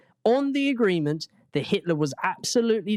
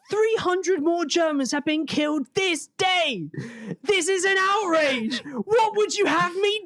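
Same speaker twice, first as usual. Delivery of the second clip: outraged shout; 14 semitones higher than usual. Acted. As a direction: rant like Hitler faking outrage at Chamberlain.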